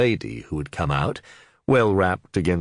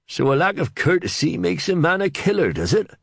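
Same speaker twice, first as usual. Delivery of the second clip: higher, mocking scornful question; scathing; 13 semitones higher, 3 dB louder than usual. Sergeant scoffing at the Reeve, higher and scornful. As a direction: sneer at the idea. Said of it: "Catchpole was scathing".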